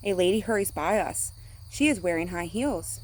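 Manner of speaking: ordinary delivery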